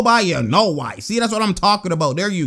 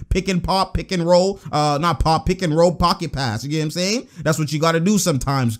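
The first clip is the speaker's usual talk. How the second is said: ordinary talk, nothing marked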